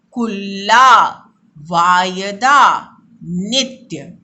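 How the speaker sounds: slow, loud, drawn-out words; slow repeat-after-me. Shown as a demonstration. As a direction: give कुल्ला, वायदा, नित्य slowly and loudly. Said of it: "फिर से मेरे साथ उच्चारण कीजिए".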